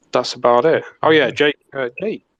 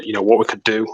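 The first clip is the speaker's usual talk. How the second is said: ordinary delivery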